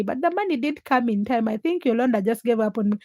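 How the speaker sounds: normal talking voice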